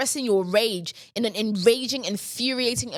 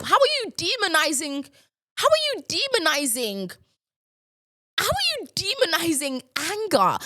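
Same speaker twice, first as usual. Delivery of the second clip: pitched up, indignant questioning; indignant rant; 5 semitones higher than usual. Real, not acted. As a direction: demand an answer, stressing are. Ranting at the commenter.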